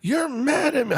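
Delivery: high, insistent imitation of a child; tearful young son. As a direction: mimic an upset little boy accusing dad. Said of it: "he breaks into tears".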